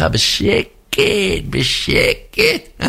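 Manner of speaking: drawn-out, rising falsetto; exaggerated slow high creep; sly creeping motorbike cop. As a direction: stretch it very slowly, climbing into squeaky highs. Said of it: "אתם מכירים את אלה?".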